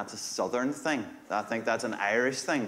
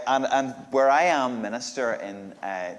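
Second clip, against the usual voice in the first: ordinary delivery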